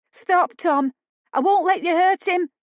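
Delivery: high, loud pleading cry; desperate shout. Acted. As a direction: scream at him to stop. Lila defends him from brother.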